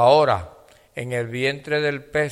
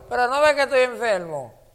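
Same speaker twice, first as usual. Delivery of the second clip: high-pitched excuse of the sick; mock protest; 10 semitones higher than usual. Preacher voicing someone too sick to worship, mock protest. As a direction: imitate a sick person objecting, pitched well up.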